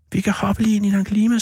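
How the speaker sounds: high and laughing. A narrator's reading. Boy laughing with relief to his brother, high and bright. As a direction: let the laugh lift it. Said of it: "sagde jeg og lo".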